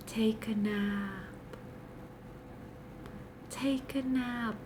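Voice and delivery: slow, soft, fading to a whisper. Pretend. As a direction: lull them to sleep.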